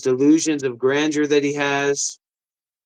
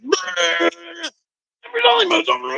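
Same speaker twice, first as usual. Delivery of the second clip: much higher mocking whine; mock fan whine; 12 semitones higher than usual. Mocking a viewer's complaint in a high whine.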